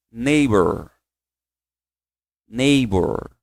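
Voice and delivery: Neighbor said without G or H sound, nay-bor; pronunciation demo. Shown as a demonstration. Teacher models Neighbor for class to repeat. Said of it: "Você fala assim, ó".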